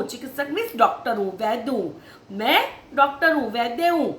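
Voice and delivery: ordinary talk, nothing marked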